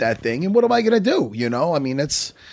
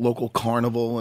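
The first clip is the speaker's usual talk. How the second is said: usual speaking voice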